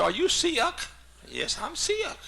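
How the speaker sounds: very high, hammed-up southern twang; mock southern squeal, vowels split in two; exaggerated southern accent. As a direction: tease with an over-the-top southern accent. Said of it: "kidding her about her southern accent".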